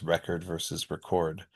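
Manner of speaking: record first as REC-ord, then as re-CORD; shifting syllable stress. Shown as a demonstration. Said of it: "where the stress shifts".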